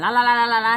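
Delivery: loud, rapid la-la-la; drowning out sound. Shown as a demonstration. Can't stand own voice on video, loud blocking la-la-la. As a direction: chant la-la loudly to block your ears. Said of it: "I wanna cover my ears".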